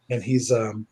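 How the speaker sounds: usual speaking voice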